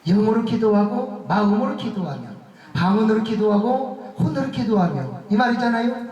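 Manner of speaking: usual speaking voice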